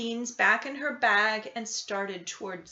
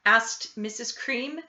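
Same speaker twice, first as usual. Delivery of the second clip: ordinary delivery, nothing marked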